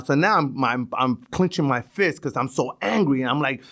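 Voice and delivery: ordinary talk, nothing marked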